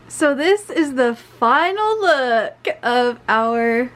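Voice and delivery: slow, grand announcer read. Real, not acted. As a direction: present it like a TV announcer. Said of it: "I sound like an announcer".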